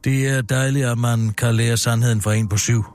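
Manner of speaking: low, measured story-reading voice; narrating a script, stage directions and all; script narration. Acted.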